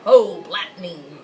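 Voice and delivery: loud, slow, booming dragon greeting; slow, loud dragon hello; Graham the dragon. Acted. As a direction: call it out big and slow like a friendly dragon. Dragon Graham voiced in story.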